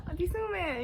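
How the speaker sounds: laughing through her aunt's name; half-laughed, high exasperated plea. Real, not acted. Teacher laughing at her aunt's prank: laughing plea.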